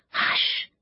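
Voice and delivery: a sharp whispered hush. A narrator's reading. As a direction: shush him in a whisper. Others silence Robert.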